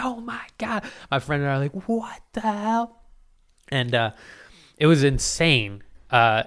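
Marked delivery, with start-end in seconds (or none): 0.0-0.8 high, soft astonished gasp; stunned gasp. Retold or imitated. Seeing coins on the road: high, hushed amazement. 1.9-3.0 high, slow stunned question; bewildered. Retold or imitated. He and his friend stare at the sky: high, slow disbelief.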